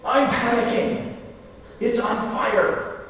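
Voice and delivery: lightly hammed-up frantic grandma; panicked grandmother. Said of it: "she turns to us".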